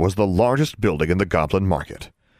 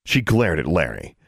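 plain reading voice

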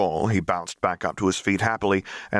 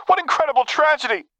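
mock cry; 4 dB louder than usual. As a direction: wail it like an overacted tragedy. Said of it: "he cried".

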